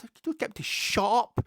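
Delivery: put-on high, loud squeaky kid voice; high, loud mimic of the boy behind him; mock child's voice. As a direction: bellow it like a noisy wee boy. Imitating the wee boy's loud chatter.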